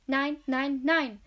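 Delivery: lautes, trotziges Nein, steigernd. A narrator's reading. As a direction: stampf es heraus wie ein Kleinkind. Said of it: "wie ein Kleinkind mit einem Wutanfall". (Peter schreit Sally trotzig an, laut)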